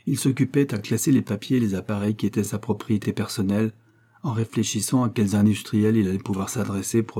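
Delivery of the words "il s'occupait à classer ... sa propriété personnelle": ordinary delivery, nothing marked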